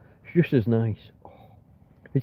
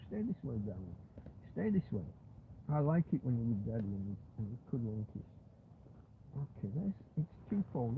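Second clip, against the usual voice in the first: hushed pet talk; 17 dB quieter than usual. Real, not acted. Settling the dog under covers.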